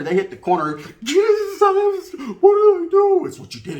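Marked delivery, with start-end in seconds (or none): 1.0-3.3 very high, very slow wailing plea; mock terrified plea. Storyteller mimics the cornered guy begging the homeboys. 3.3-3.8 whispered menace; cold whisper. Retold or imitated. Storyteller voices homeboys coldly answering the victim.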